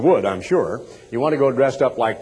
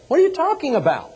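high, quick mock disbelief; hammed-up incredulous reply; acted bafflement; 13 semitones higher, faster than usual. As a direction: fire back a puzzled retort, pitched way up. Acted baffled reply at costume party.

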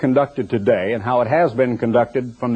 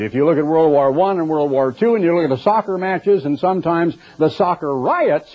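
ordinary delivery

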